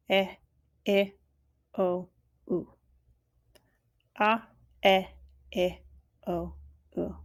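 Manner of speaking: each vowel clipped and held apart; vowel sound drill. Shown as a demonstration. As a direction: model vowels for learners. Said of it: "So we have a".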